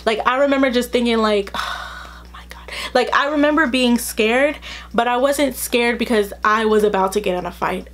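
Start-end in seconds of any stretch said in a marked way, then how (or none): 1.5-2.7 breathless, unvoiced whisper of dismay; whispered dread. Remembering the fight threat, a whispered oh my God. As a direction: breathe it out in horror. Recalling a scary moment, after sighing.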